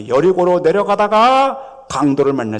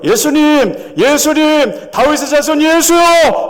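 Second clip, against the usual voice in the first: building from loud to louder and higher; crescendo cry; 11 semitones higher, 7 dB louder, slower than usual. Acted. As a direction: swell each call, louder every time. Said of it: "이거는 크레센도예요".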